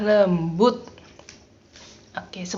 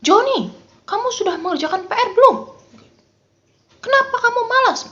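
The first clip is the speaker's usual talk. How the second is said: high, loud, fast mock scolding; scolding mother; 13 semitones higher, 5 dB louder, faster than usual. Mother scolding her child over homework, high and loud. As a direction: play the nagging mom who's fed up. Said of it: "Misalnya mama marah ya".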